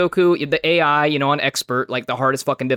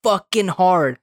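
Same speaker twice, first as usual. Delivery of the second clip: slow emphatic; 6 semitones higher, much slower than usual. Real, not acted. Exasperated emphasis on difficulty.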